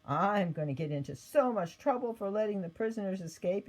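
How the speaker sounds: plain reading voice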